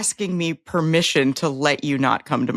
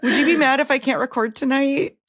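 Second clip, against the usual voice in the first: higher, sing-song fake politeness; mock passive-aggressive; 8 semitones higher than usual. Host teasing cohost with mock sweet text.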